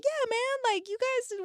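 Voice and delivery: squealed high, careless whoop; brushing off the stuffy crowd, high squealing cheer; high mock cheer. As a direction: pitch it way up like you don't care who hears. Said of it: "And I'm like".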